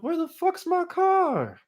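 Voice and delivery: high, loud, stretched-out wail; hammed-up panicked shriek; alarmed yell. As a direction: cry out at a missing car. Discovers car is gone.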